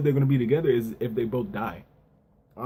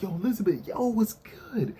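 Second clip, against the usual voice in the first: Diane greeting; 8 semitones higher than usual. Retold or imitated. Retelling Diane greeting Elizabeth.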